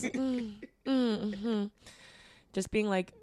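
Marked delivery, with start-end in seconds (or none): 0.0-1.6 minimal, noncommittal polite hum; retold barely polite acknowledgement; mildly polite. Woman giving a chatty stranger nothing, mildly polite. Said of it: "mildly polite".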